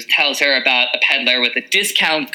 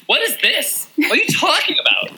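incredulous; 12 semitones higher than usual. Retold or imitated. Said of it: "But I was like".

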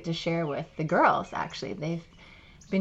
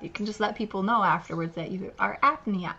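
ordinary talk

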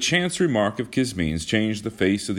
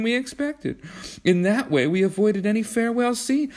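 a hint of a higher, calm girl; lightly voiced Kismine; 13 semitones higher than usual. A narrator's reading. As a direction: explain it matter-of-factly, higher. Kismine explains calmly.

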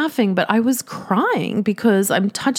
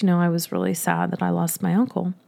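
ordinary talk

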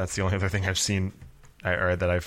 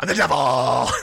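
loud, higher cartoon-villain shout; hammed-up devil; 5 semitones higher, 7 dB louder, slower than usual. Co-host echoes the devil joke back, hammy and loud. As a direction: bellow it as a silly devil. Echoing the mock devil punchline.

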